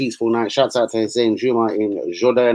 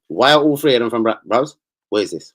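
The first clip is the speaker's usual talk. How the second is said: ordinary talk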